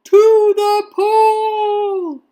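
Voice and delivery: high, loud, long-drawn rallying cry; yell. A narrator's reading. Firemen announcing where to go, long loud yell. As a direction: stretch each word in a big shout. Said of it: "yelled the firemen".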